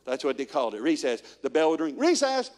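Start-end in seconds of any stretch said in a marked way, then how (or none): 2.0-2.5 higher and louder, gleeful; excited kid shout. Retold or imitated. Kids at school when the bell rings, gleeful shout.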